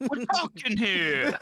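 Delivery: high, slow laughing retort; slow, laughing mock snap; laughing mock annoyance. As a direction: giggle a fake complaint.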